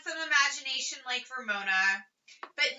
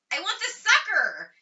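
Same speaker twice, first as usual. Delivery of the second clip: put-on a higher, louder child's scream; 7 semitones higher, 4 dB louder than usual. A narrator's reading. Ramona screams for the sucker in a tantrum. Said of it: "screamed Ramona".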